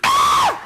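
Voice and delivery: short, very high, loud shriek; imitated mother's scream. Mother seeing the creature get out, imitated shriek. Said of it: "And my mother goes".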